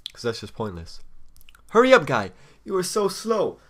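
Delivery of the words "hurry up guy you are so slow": loud, high, fed-up jeer at the opponent; impatient taunt. Real, not acted. Taunting slow opponent online.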